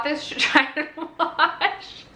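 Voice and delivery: slow, laughing confession; embarrassed laughter. Real, not acted. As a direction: laugh helplessly through the words. Laughing at embarrassing product use.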